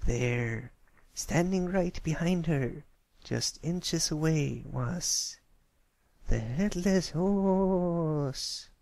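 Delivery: slow, drawn-out spooky reveal; creepy ghost-story voice. Acted. Scootaloo revealing the headless horse, slow and creepy. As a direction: stretch out the scary ending. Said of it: "in a low, creepy voice".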